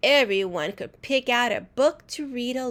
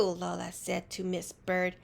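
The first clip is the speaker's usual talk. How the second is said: ordinary delivery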